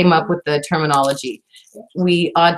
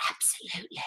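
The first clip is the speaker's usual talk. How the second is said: barely voiced whisper; hushed, tiny whispered aside; 22 dB quieter, faster than usual. Real, not acted. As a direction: whisper it, very soft, almost no voice. Echoing her own word hushed.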